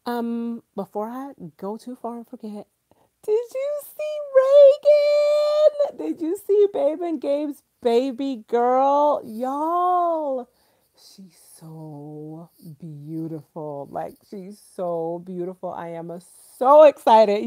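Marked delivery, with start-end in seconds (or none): 3.2-10.5 high, slow, drawn-out gushing squeal; excited squeal. Real, not acted. Gushing over friends' new baby. 10.9-13.9 tender gush. Real, not acted. Melting over baby photos.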